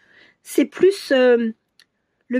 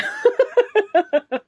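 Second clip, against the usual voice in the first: long, higher burst of laughter; real amused laugh; 10 semitones higher than usual. Real, not acted. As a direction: crack up at your own joke. Laughs at own English accent.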